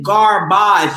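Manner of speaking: "garbage" as gar-BAHZH, slow, with a French ending; French-style garbage. Shown as a demonstration. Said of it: "Garbage is French".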